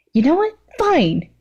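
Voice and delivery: high, fed-up snap to fine; an annoyed high-pitched girl giving up; exasperated villain. Acted. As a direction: give in with a huff. Mimikis voiced, exasperated.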